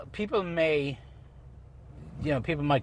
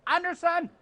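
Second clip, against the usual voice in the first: high hailing call. Real, not acted. Spotting Anderson from the booth, high playful call. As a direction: call across the hall to get his attention.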